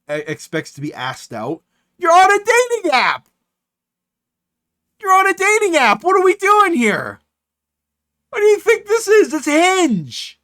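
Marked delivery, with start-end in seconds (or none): 2.0-10.4 loud, high-pitched incredulous yelling; comic outraged shouting, pitched way up; exasperated rant. Real, not acted. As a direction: yell it in sheer disbelief. Ranting at the message writer.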